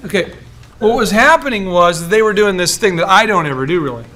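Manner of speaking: usual speaking voice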